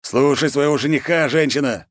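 an angry father's bark; boiling over. Acted. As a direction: snap at her in anger. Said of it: "вскипел Морозов-старший". (вспылил)

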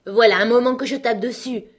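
high, loud, exasperated complaint; impatient. A narrator's reading. Alice has been knocking for ages, loudly impatient. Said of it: "s'écria Alice impatientée".

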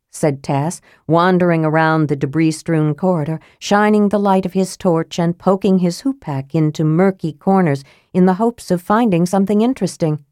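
ordinary delivery, nothing marked

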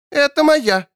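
put-on high, startled cry of a child; frightened outburst. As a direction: snap up clutching a toy. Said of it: "вскинулся, боязливо прижимая".

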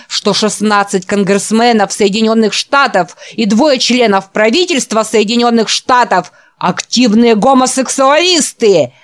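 loud, rising preacher's sermon; fiery preaching. Acted. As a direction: preach it hellfire style. Gardner's recorded sermon. (пламенная проповедь)

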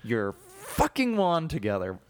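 hammed-up exasperated schoolgirl order; imagined sweary Hermione. Imagined Hermione ordering Ron about, mock exasperation. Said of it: "what I would imagine this character was saying".

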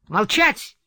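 sharp loud order to be silent; shouted command. A narrator's reading. Heathcliff shouting at Catherine to be silent. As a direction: cut her off with a shout. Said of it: "вскричал он".

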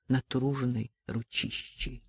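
plain reading voice

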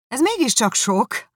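magasabb, hangosabb méltatlankodás; fellelkesült tiltakozás; kiáltás. Acted. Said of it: "kiáltott Bingley". (magasabb, hangosabb felkiáltás)

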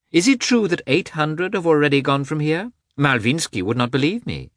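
high-pitched, eager society lady; eager princess. Acted. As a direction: voice a princess keen to be proved right. Voices the princess in dialogue.

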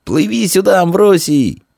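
high-pitched hail across water; calling out. A narrator's reading. Boy on the shore to swimming friend, calling out. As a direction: shout high for a friend to come. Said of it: "Крикнул".

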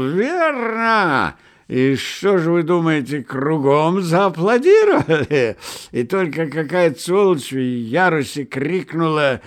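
put-on loud, high drunken tale; drunken shout. A narrator's reading. Drunken Karas telling of the theatre, shouting. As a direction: bellow the story gloomily. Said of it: "мрачно крикнул опьяневший Карась".

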